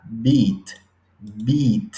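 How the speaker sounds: long vowel in beat. Shown as a demonstration. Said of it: "sonido más largo que el de la I española".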